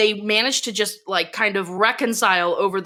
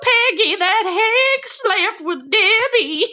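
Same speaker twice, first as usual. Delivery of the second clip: put-on high, slow, quavering old lady; little old lady voice; 12 semitones higher, 4 dB louder, slower than usual. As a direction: squeak it out like a frail granny. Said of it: "putting on this little old lady voice".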